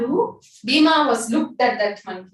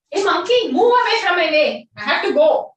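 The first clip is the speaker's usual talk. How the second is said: higher, louder order to move aside; commanding shout; 8 semitones higher, 5 dB louder than usual. Acted. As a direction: bark it at the little monkey. Bhima orders monkey off path.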